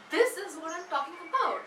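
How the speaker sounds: "this" hit high, then falling; high, firm opening on "this"; emphatic stress. Shown as a demonstration. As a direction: punch "this" to grab attention. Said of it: "So when you stress".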